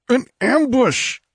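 high, slow cry of warning; drawn-out high exclamation; alarmed cry. A narrator's reading. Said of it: "cried Conan".

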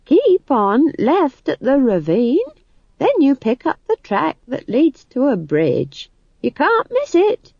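high, singsong ready-made directions; prompt old Nanny. A narrator's reading. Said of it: "said Nanny promptly".

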